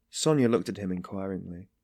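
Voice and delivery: plain reading voice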